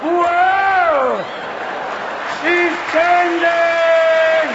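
high, slow, drawn-out alarm; alarmed shout. Acted. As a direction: yell in horror. Monster transformation.

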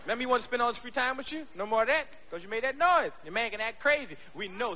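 ordinary talk

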